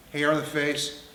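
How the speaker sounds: normal talking voice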